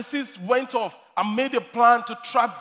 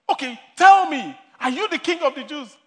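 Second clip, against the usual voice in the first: loud, higher demanding question; upset Pilate demanding; 6 semitones higher, 8 dB louder than usual. Retold or imitated. Pilate, upset, demands an answer from Jesus: loud, higher question. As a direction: Pilate losing patience, pressing hard.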